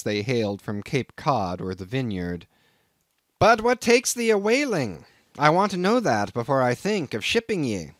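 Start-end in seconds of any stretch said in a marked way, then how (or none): 3.4-7.9 put-on higher, louder voice of a suspicious old Quaker captain; crusty Nantucket captain sizing up a greenhorn; gruff old sea captain. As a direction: bristle at the stranger like a crusty old skipper. Old captain questions Ishmael.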